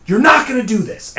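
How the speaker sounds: high, loud angry outburst; furious shout. Retold or imitated. Sleeper snapping at the cousin's never mind, furious shout. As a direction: snap and shout in refusal. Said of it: "like, furious".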